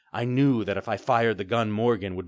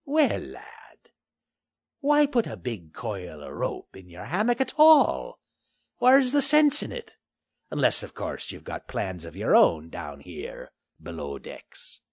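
put-on a taunting old sea pirate; gruff pirate; 3 dB louder than usual. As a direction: tease the cornered lad, pirate drawl. Pirate Finley voiced.

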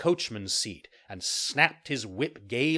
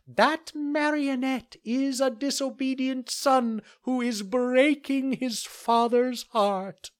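much higher, louder, very slow cricket scolding; a tiny insect denouncing a rascal; 5 dB louder, slower than usual. Acted. Cricket condemns Pinocchio.

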